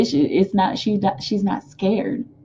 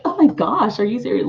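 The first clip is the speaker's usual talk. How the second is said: genuinely surprised; 4 semitones higher than usual. Retold or imitated. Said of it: "you were really, like, genuinely surprised".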